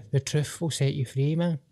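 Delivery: normal talking voice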